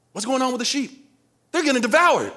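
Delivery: put-on high-pitched boy's dismay; acted rising worry; mock alarm. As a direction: play the boy spotting danger. Acts boy seeing sheep attacked.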